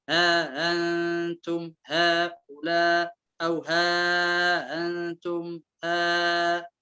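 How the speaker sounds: ها أنتم هؤلاء slowly chanted with held vowels; elongations in ها أنتم هؤلاء. Shown as a demonstration. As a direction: stretch the madd in ها أنتم هؤلاء. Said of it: "قصر، هنا توسط، هنا تسهيل".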